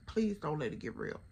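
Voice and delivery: low, quiet plea under the breath; muted pleading. Retold or imitated. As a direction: imitate praying privately that trouble won't start. Retold worried plea muttered to herself.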